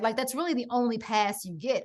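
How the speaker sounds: ordinary delivery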